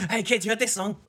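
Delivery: high, hammed-up party-parent call; mock drunk parent. Drunk dad to kids on drive home, hammed-up high call. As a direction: play the tipsy parent keeping the party going.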